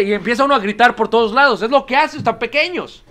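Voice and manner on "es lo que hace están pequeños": ordinary talk, nothing marked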